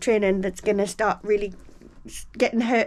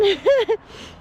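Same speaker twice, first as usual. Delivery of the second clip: breathless laugh; 12 semitones higher, 5 dB louder than usual. Real, not acted. Laughing at her own breathing.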